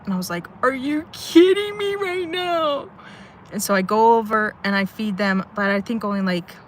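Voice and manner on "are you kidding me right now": very high, slow, drawn-out mock wail; incredulous wail. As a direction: wail it in hammed-up disbelief.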